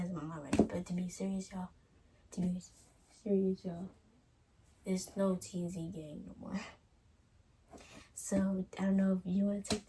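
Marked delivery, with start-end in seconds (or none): none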